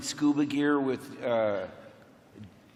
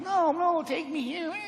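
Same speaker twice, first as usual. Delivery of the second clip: put-on much higher, pleading little-boy voice; whiny kid; 12 semitones higher than usual. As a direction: beg like an eager kid. Pleading kid sidekick acted out.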